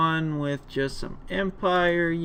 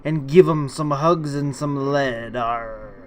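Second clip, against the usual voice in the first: gruff, loud swashbuckling pirate captain; 6 dB louder than usual. Acted. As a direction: growl it like a sea dog hailing the crew. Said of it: "I hope you like my pirate voice".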